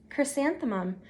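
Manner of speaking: ordinary delivery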